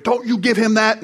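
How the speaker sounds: a panicked mother forbidding it; alarmed wife. Retold or imitated. Mother warns husband not to give baby cockroach, mock alarm.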